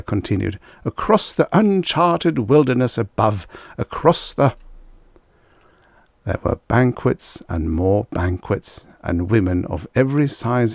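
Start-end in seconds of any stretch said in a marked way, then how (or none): 0.8-4.6 high, swelling speechmaking; grandiose oratory. A narrator's reading. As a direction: orate with lofty wonder. Mayor continues grandiose speech.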